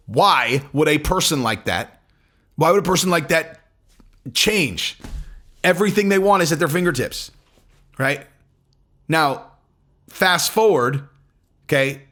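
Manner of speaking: ordinary talk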